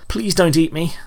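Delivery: high, scared plea; frightened pleading. A narrator's reading. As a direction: beg the bear for mercy, high-pitched. Pleads with a bear.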